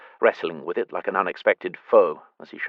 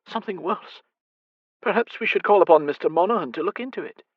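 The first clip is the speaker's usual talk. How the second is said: higher, worried Scottish lady; the fretful mother at night; lightly higher mother, uneasy; anxious blind mother; 11 semitones higher than usual. Acted. Mother's long worried speech.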